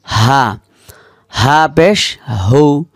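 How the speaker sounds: ordinary talk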